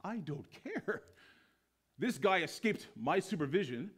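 high-pitched, merciless declaration; hard, measured pronouncement; irate commandant. Acted. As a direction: refuse the plea and condemn them all. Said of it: "the commandant's like".

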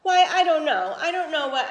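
sudden loud, startled blurt that stumbles over itself; a taken-aback mother stammering; flustered surprise. Acted. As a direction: caught off guard, fumbling for words. Flustered mother answers proposal.

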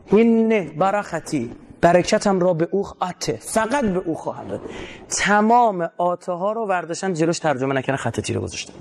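ordinary talk, nothing marked

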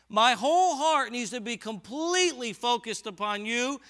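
high, loud, impassioned preaching; full-force preaching. Acted. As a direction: preach it out with fire. Passionate preaching on undivided heart.

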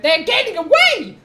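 high, loud, drawn-out mimicry of Martin's accent; imitating Martin's accent. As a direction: cry out in a mock version of Martin's accent. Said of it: "not as bad as Martin's accent".

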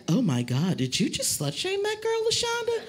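shocked friend. Retold or imitated. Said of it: "my friend was like".

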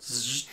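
buzzing sliding-door sound effect; imitated door buzz. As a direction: make a drawn-out mechanical zzz with the mouth. Game master imitates door opening.

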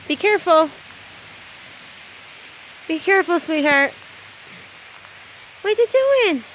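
high, loud sing-song pet voice. Real, not acted. Owner talking to her dog in the storm: high cooing baby talk.

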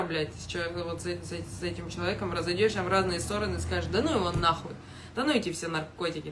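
ordinary delivery

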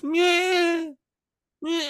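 very high mock baby whimper; imitated baby whimper. Mimicking the baby in the cinema, high mock whimper. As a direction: copy a baby fussing, high. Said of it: "I start hearing this".

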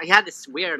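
ordinary talk

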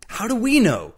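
high-pitched, agitated outcry; exasperated cry. A narrator's reading. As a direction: throw it back, voice shooting up. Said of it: "cried Travis".

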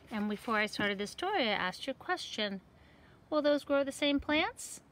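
ordinary delivery, nothing marked

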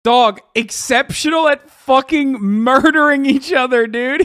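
loud, high disbelief; incredulous outburst. Real, not acted. Incredulous at the replayed answer.

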